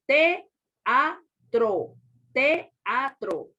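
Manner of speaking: TEATRO very slowly as te... a... tro; syllable-by-syllable TEATRO, E and A kept apart; divided into syllables. Shown as a demonstration. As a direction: pause between the three syllables of TEATRO. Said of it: "en sílabas, usted pone".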